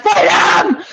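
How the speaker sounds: loud, high cry of freedom; gleeful, hammed-up battle cry; exultant shout. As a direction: yell it like a new driver let loose. Acting out first solo drive.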